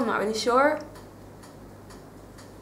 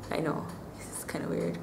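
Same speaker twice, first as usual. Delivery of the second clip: whispering; 11 dB quieter than usual. Real, not acted. Embarrassed aside.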